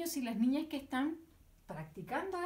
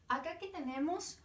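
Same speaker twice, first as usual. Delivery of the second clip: ordinary talk, nothing marked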